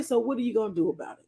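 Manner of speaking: ordinary delivery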